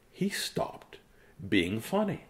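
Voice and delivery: hushed whisper; whispered narration. A narrator's reading. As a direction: whisper it for suspense. Dramatic reading pause.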